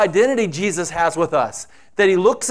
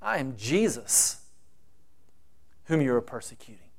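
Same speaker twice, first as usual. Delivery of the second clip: much softer, low and grave; hushed, dreadful words; 5 semitones lower, 12 dB quieter than usual. Acted. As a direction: drop to a low, dreadful hush. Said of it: "the most horrific words".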